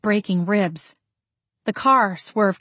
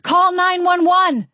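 high, loud, slow urgent shout; desperate shouted command toward the shop; 10 semitones higher, 5 dB louder, slower than usual. A narrator's reading. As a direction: bellow the order for help. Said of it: "I shouted".

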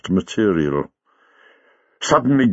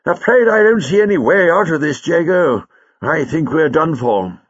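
despondent; 8 semitones higher, 4 dB louder than usual. Acted. Lightfoot tells Jago there is no way out, despondent. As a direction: give up hope, starting loud and high. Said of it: "Lightfoot sighed despondently".

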